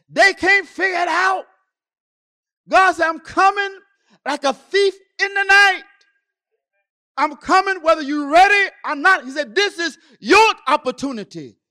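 high, loud warning from the pulpit; full-force preaching. Real, not acted. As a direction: ring out God's warning to everyone.

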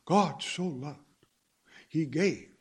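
slow, leaning on "God so loved" and "he gave"; mock churchy emphasis on "God so loved, he gave"; imitating their emphasis. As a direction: dwell on "loved" and "gave" like they do. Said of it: "they always emphasize".

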